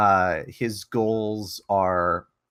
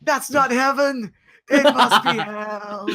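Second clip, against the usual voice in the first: laughing; 13 semitones higher, 6 dB louder than usual. Acted. Joking afterlife scene, laughing.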